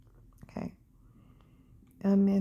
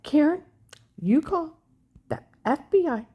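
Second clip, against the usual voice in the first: louder, urging her to call the FBI; urgent retold call; 4 semitones higher, 6 dB louder than usual. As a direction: push it like an insistent sister.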